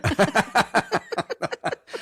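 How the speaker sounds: high laughing burst; burst of laughter. Real, not acted. Laughs at rotten carcass.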